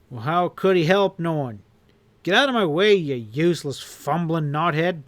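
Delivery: angry. Acted. Boss furious at henchman.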